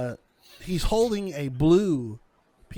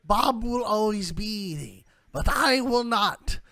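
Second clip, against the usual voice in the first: high, slow, wailing crone; old lady fortune teller; 6 semitones higher, 3 dB louder, much slower than usual. Acted. Old woman says there is no time, high drawn-out crone voice. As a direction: urge them in with long drawn-out high words. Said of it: "old, old lady".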